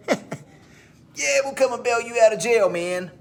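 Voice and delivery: high, jokey dude voice; mock carefree buddy. Mocking people who think bail is a joke, in a silly dude voice. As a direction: laugh off a friend's arrest. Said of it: "people think it's funny".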